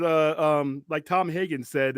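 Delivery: ordinary delivery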